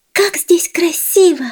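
high squeaky little mouse; tiny high mouse voice. Acted. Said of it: "сказала городская мышь".

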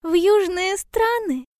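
high, eager small-animal voice; delighted little hedgehog. A narrator's reading. Hedgehog overjoyed at bear's travel idea, high delighted voice. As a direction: ask it high with happy surprise. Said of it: "обрадовался ёжик".

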